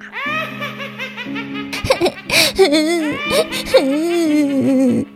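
high evil cackle; witch cackle. Acted. Witch laughing after curse.

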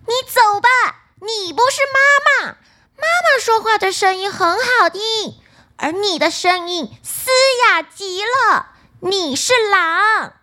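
put-on high, loud childlike shout; shrill kids' chorus shouting at the door; little goats shouting. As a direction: yell at the wolf to go away, pitched well up. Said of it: "他们大声说".

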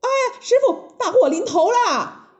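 high alarmed cry to master; calling out. Acted. Monkey rushes back calling his master, alarmed and high. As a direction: shout the warning in a panic. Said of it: "叫着叫着".

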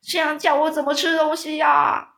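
high, loud wail; greedy giant wailing about not being able to eat; wailing loudly. A narrator's reading. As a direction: whine it out in a howl. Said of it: "哇哇大叫说".

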